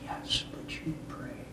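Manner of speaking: barely audible, whispered to oneself; whispered thought. Retold or imitated. Speaker puzzles over God's answer, whispered thought. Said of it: "And so I thought".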